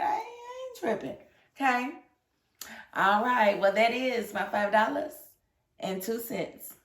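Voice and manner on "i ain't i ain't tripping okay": mock squeal. Mock claim of not being mad.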